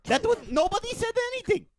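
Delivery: fast, high, put-on voice; high disguised voice. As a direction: rush it in a squeaky disguise. Denying in a disguised voice.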